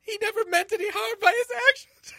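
high, loud tearful woman. Acted. Reads Carthena's lines in voice.

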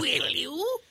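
squeaky coy falsetto plea; coy high plea. Acted. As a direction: bat the lashes and squeak it.

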